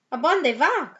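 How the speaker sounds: loud, high call. A narrator's reading. Skipito hails his amigos on the river, calling out loudly. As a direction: call out to the pack. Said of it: "called out Skipito".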